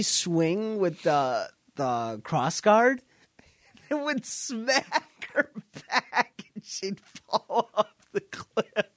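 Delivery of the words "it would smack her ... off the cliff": slow, laughing through the words; can't stop laughing while telling it. Real, not acted. Recounting the boss falling off the cliff, laughing.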